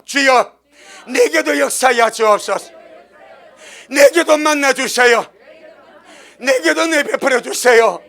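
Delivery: loud, slow, insistent begging; holy stubborn plea. Retold or imitated. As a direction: demand grace, pleading loudly. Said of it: "그런 거룩한 오기".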